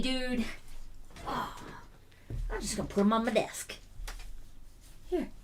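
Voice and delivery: usual speaking voice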